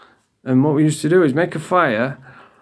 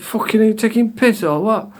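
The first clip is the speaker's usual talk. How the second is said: higher-pitched retold indignation; indignant; 6 semitones higher than usual. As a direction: protest in mock disbelief at being short-changed. Retold complaint about prison breakfast.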